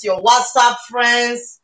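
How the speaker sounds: normal talking voice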